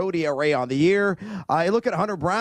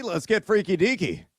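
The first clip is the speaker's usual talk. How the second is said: ordinary talk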